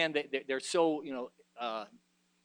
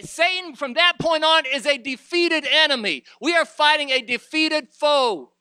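higher and louder, fiery pulpit voice; preaching at full force; 10 semitones higher, 12 dB louder than usual. Acted. Preacher rousing the church against a defeated foe, fiery preaching. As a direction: whip the congregation up.

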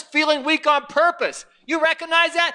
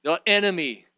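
ordinary talk

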